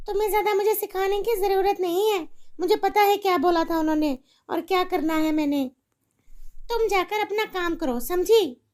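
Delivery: a careless mother brushing off her daughter; irritated mother. Acted.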